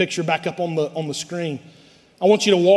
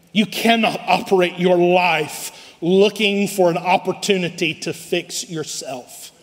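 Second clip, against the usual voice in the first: ordinary talk, nothing marked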